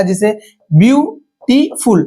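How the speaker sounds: ब्यूटीफुल drawn out slowly, each syllable apart. Shown as a demonstration. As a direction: stretch ब्यूटीफुल into its three beats. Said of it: "इसमें तीन syllabus हुआ".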